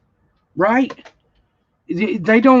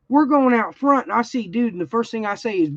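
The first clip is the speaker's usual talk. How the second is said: normal talking voice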